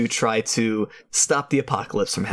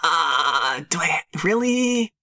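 put-on higher, earnest protesting kid voice; wholesome 1950s kid; 4 semitones higher, much slower than usual. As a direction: plead like an innocent 1950s boy. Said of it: "Aw, jeepers, mister".

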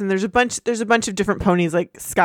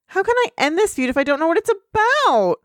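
exasperated; 8 semitones higher than usual. Acted. Acted frustrated pony mediator.

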